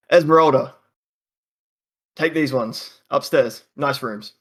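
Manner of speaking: loud call to someone in the back. Acted. Innkeeper calls Esmeralda to show guests upstairs, loud call. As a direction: shout her name, then settle.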